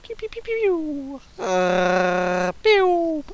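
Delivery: high, slow playful laser pews; silly pew sounds. Pretend. Gamer making silly laser sounds while shooting, high pew noises. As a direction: make goofy blaster noises. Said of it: "I'm just being silly".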